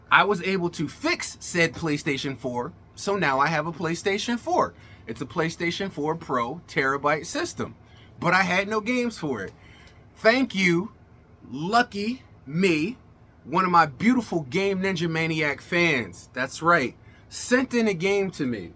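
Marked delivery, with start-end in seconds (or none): none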